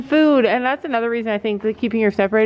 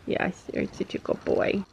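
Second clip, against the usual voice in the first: 1 soft whisper; 3 semitones lower, 9 dB quieter than usual. Real, not acted. Soft praise to dog.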